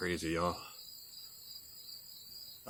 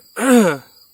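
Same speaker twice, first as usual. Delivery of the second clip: loud, rough throat clear; harsh throat clearing; 13 semitones higher, 18 dB louder than usual. Real, not acted. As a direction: clear the throat hard after choking. Swallowed down wrong pipe.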